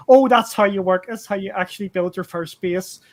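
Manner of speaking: mock eureka outburst; belated realisation. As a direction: retell the moment it finally clicks. Retells own late realisation.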